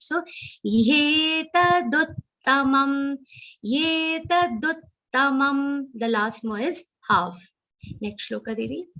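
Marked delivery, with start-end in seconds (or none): 0.0-5.8 "ye" said whole and clear, not "tat"; full ye. Shown as a demonstration. Teacher correcting Gita recitation, clear full ye. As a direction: chant slowly, giving "ye" its full sound. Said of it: "ye is complete".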